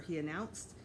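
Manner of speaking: ordinary delivery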